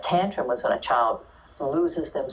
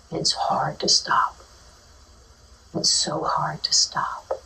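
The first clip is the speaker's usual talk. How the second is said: slow, gentle whispered empathy; soft, slow whisper; slower than usual. Shown as a demonstration. Teacher acknowledging a child's feelings in a slow whisper.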